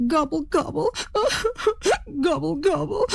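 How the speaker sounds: high gobbles broken by sobs; acted boo-hoo in turkey gobbles; crying turkey. A narrator's reading. Magnificent bird starts to cry, sobbing gobbles. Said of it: "magnificent bird started to cry".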